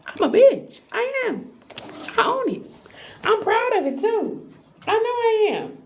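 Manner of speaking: high, proud and defiant; sassy pride. Real, not acted. Proudly owning being a bitch.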